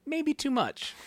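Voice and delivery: squeaky-high mock verdict, falling at the end; high playful voice. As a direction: jump up into a very high, playful pitch. Playful shortened one-line review.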